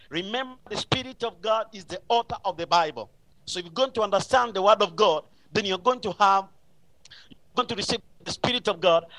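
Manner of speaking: ordinary talk, nothing marked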